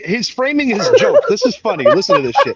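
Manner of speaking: high and loud, laughing hype; excited hype. Real, not acted. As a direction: rev up the room, laughing. Laughing, hyping the story.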